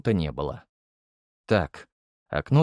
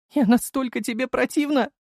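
very high, wounded woman's cry; shrieky, acted feminine anguish; tearful hurt. A narrator's reading. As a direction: wail it as tears start. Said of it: "потекли слёзы из глаз Царёвой".

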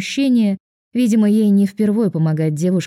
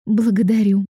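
fake politeness; 3 semitones higher than usual. A narrator's reading. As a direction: sweetly fake polite thanks. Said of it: "притворно вежливо отозвалась я".

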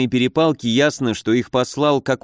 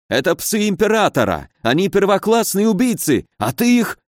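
high, agitated baron voice; near-shout; 8 semitones higher than usual. A narrator's reading. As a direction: exclaim in high-pitched disbelief, almost shouting. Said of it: "чуть ли не выкрикнул он". (почти выкрикивая, высоким взволнованным голосом)

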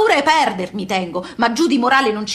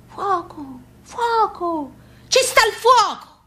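alarm cry; 8 semitones higher, much slower than usual. Retold or imitated. Alarm of fire on ship.

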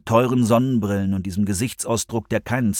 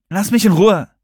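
high, loud, huffy rebuff; indignant outburst, pitched up; snorting annoyance; 10 semitones higher, 5 dB louder than usual. A narrator's reading. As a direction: snort it and brush him off. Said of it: "schnaubte Serenity".

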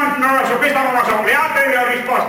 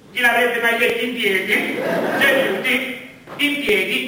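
liturgical calls; 2 semitones lower, 5 dB quieter, slower than usual. Acted. Priest directing the faithful to sit and stand. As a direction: call the congregation up and down like a tired priest.

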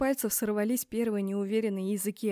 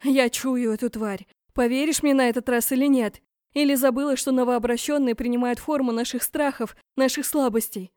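loud, higher urgent argument; heated demand; 3 semitones higher, 7 dB louder than usual. A narrator's reading. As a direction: press her forcefully to believe. Urgent argument to convince Borne. (громко и горячо убеждать)

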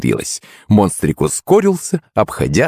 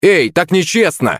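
raised-pitch protest; indignant exclamation; 9 semitones higher than usual. A narrator's reading. Blond rich kid protesting foul play, exclaiming. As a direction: cry out in higher-pitched protest. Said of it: "воскликнул белобрысый мажор".

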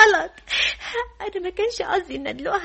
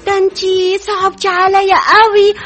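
slow, loud mock pity; hammed-up, drawn-out commiseration; 6 dB louder than usual. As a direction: draw out exaggerated sympathy, louder. Mock pity over elderly groom.

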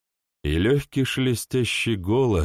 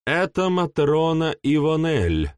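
pleased; 8 semitones higher than usual. A narrator's reading. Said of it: "не без удовольствия произнесла".

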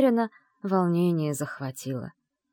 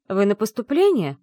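louder, strict official question; stern; 3 semitones higher, 6 dB louder than usual. A narrator's reading. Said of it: "строго спросила пожилая женщина". (строгий вопрос)

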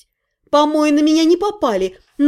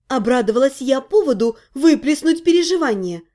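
plain reading voice

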